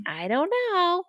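put-on high childish I don't know; high little-kid shrug. Eight-year-old shrugging.

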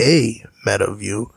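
slow, with "a" drawn out as "ay"; stress on A. Real, not acted. Repeating the point to the interviewer, slow stress on "a" as "ay". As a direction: repeat it slowly, leaning on "a" as "ay".

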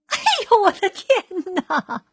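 put-on old woman yelping at the elephant; startled yelp. A narrator's reading. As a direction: yelp jumping way up in pitch. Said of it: "瘦小的老妇人喊起来".

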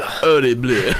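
"Horrible" as "or-ree-bluh", hammed-up French; French accent. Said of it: "you can say it's French".

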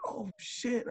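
a small high yelp of alarm; imitating his own startled self; mock shocked reaction. Reacting to coach's outburst.

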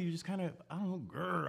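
light mock growl on grr, playful tiger purr. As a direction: lightly imitate a flirty friend ending in a little growl.

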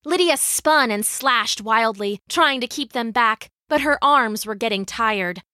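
usual speaking voice

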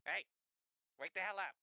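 high, insistent calling out; rough wake-up call. Acted. Character at the cart waking Zephyr, impatient call. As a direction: rouse a sleeper impatiently.

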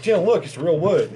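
high-pitched mock eagerness; eager re-enactment. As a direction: act out showing off a find. Retells own eager remark to friend.

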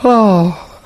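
ordinary talk, nothing marked

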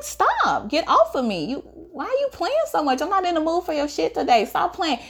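brushing him off as a joke; teen telling a friend he plays too much; playful protest. Retold or imitated. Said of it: "I'm laughing".

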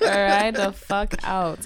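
slow laughing Trini drawl; laughing Trini accent on request. Shown as a demonstration. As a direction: stretch it out in a Trini lilt, laughing. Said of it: "little accents".

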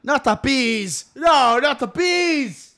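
high, slow, hammed-up shout; Nicolas Cage screaming. Friend spoofing the Wicker Man, high drawn-out mock scream. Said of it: "think of Nicolas Cage and Wicker Man".

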